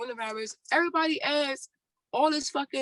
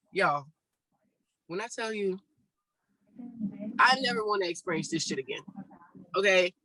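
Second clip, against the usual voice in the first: ordinary talk, nothing marked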